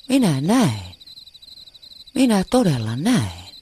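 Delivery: loud, high cry of joy; excited shout. A narrator's reading. The cub can see again, shouting with joy. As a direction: burst out with delight. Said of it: "Pikkuleijona huusi innoissaan".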